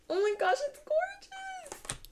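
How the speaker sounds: very high, soft, slow, near tears; overcome with emotion. Real, not acted. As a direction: gush tearfully at the beauty. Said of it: "let me calm down".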